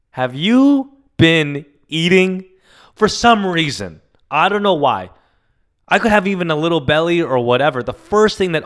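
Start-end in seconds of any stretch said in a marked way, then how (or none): 0.1-2.5 slow, higher caring grandma voice; slow higher imitation of a fussing grandma; Filipino grandmother. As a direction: drag it out like a doting grandmother.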